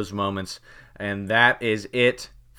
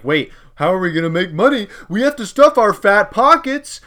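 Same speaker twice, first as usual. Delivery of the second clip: greedy executive; 9 semitones higher, 9 dB louder than usual. Retold or imitated. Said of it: "they were like".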